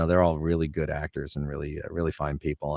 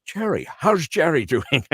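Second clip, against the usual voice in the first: delighted Connery asking after Jerry; Connery impression in the retold meeting; Sean Connery; 10 semitones higher, 4 dB louder than usual. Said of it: "He goes".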